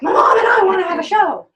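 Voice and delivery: loud, high excited shout; excited young client calling out; eager booking. Retold or imitated. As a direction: blurt it out thrilled.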